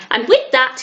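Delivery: ordinary delivery, nothing marked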